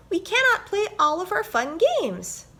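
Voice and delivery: high, loud squeaky mouse voice; mouse squeak. A narrator's reading. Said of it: "squeaked Lizzie Mouse".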